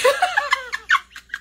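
high-pitched real laugh bursting out; burst of laughter. Real, not acted. Laughing to the live viewers over the MC's slip, high cackle. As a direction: crack up at your own story. Cracking up at own story.

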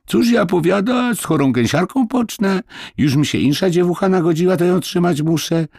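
put-on a boy aping the grumbling landlady; mimicking the landlady.

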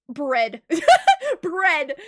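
giggling out the word bread between high laughs; laughing at an item called bread. Real, not acted. Streamer cracking up at an in-game item, laughing.

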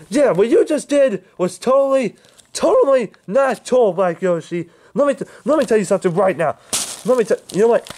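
furious. Acted. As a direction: lay into him in rage. Furious confrontation continues.

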